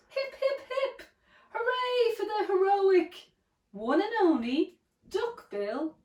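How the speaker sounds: high, jubilant storybook cheer; hooted cheer. A narrator's reading. Duckbills celebrate their hero Drip, high hooted cheering. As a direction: hoot three high hips, then sweep into hooray. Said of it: "They hooted".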